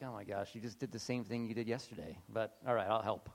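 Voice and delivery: low, soft weary sigh of a voice; exasperated God. Retold or imitated. Preacher voicing God tired of our mistakes, low and soft. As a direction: sound tired but willing. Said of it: "God's like".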